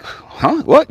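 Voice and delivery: high, startled double take; quick, puzzled "huh?" jumping up high; caught off guard. Retold or imitated. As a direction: react as if blindsided. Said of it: "it just came out of left field".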